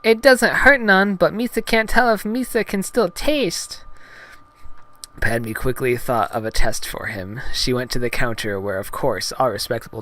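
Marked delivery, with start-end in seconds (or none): 0.0-3.9 high cartoon alien voice; Jar Jar Binks. Gungan reporting on his numb tongue, high goofy voice. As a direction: explain in goofy creature falsetto. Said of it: "Jar Jar focused on his tongue".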